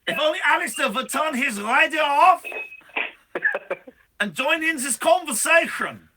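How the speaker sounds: loud, high hammed-up Swiss shout; mock Swiss rallying cry. Host in a Swiss voice loudly calling for Alistair, mock rally shout. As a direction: call out for Alistair like a Swiss patriot. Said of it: "It's a Swiss accent".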